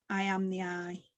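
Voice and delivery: low, hushed mantra on the out-breath; mantra demo. Shown as a demonstration. Teacher shows the group the out-breath line, low meditative mantra. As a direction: let it sink softly as you exhale. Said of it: "exercise goes like this".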